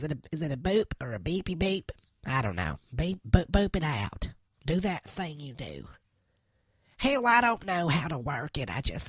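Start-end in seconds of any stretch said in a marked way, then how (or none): none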